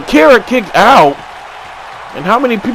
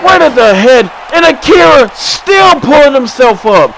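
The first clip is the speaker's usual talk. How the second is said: excited shout; 8 semitones higher than usual. Real, not acted. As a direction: yell over the chaos. Wild steel-stairs spot.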